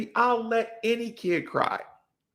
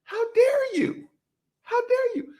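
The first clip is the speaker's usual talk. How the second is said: much higher, hammed-up indignant shriek; mock outrage. Comic mocking the feminist friend, high mock outrage. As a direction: play it as theatrical offended outrage. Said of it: "I'm like".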